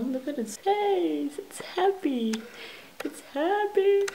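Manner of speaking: slow, high cooing over cute face. Real, not acted. Vlogger coos over happy pencil case, slow high coo. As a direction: coo at it like it's adorable.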